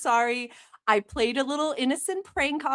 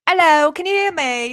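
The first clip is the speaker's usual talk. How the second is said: put-on louder British-accented hello; fake British accent; 3 semitones higher, 6 dB louder than usual. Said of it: "I'm not really British".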